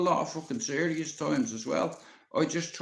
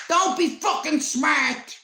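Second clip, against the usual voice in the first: put-on much higher, louder, slow old-woman snap; granny snapping; 13 semitones higher, 6 dB louder, slower than usual. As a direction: bite his head off like a furious granny. Granny snaps at cheeky grandson.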